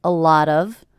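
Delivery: "A lot of" with the T in "lot" tapped like a D; flap T in "lot". Shown as a demonstration. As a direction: run "A lot of" together, T as a quick D. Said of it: "It sounds like a D".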